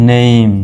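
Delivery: নেম as "neim", with a long gliding vowel; careful "neim" for নেম; model pronunciation. Shown as a demonstration. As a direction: draw নেম out into "ne-im". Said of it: "কেমন উচ্চারণ?".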